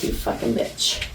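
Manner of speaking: low, hushed voicing of the cat; softly imitated feline grudge; imagined cat's reproach. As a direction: mutter it as the offended cat. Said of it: "she's looking at me like".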